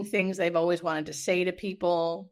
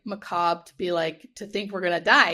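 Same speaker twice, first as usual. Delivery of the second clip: ordinary delivery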